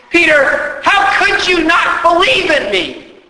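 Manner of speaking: high, loud scolding yell; hammed-up furious rebuke; mock angry yell. Preacher voicing an angry Jesus yelling at Peter, loud. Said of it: "he doesn't yell at Peter and say".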